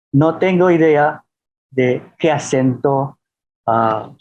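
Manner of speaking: ordinary delivery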